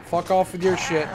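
normal talking voice

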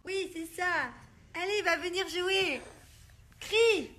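high-pitched coaxing; high playful mum voice. Real, not acted. Encouraging baby to call.

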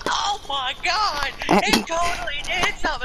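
high, loud, gushing mock amazement. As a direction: gasp as if the ghost just answered. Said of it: "they're like".

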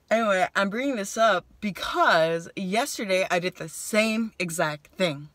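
ordinary delivery

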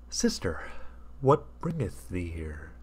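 slow, quiet, lightly solemn voice; a hint of gentle biblical gravity; lightly voiced Jesus. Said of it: "said Jesus".

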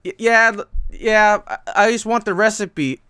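flustered, stumbling over words; awkward stammer. Retold or imitated. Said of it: "I felt awkward being like".

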